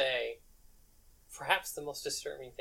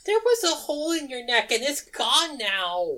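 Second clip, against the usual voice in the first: alarmed; 12 semitones higher, 8 dB louder than usual. Acted. Alarmed over vanished wound.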